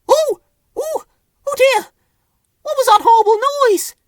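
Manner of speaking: very high, squeaky, loud panicky voice; tiny high-pitched worried creature; frightened Whimsy. A narrator's reading. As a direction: squeal it in alarm. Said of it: "she wondered".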